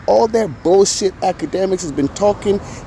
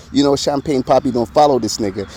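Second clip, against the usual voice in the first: ordinary talk, nothing marked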